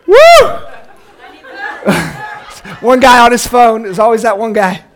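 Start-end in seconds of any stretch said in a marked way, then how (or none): 0.1-0.5 excited woo. Pretend. End of pretend ride.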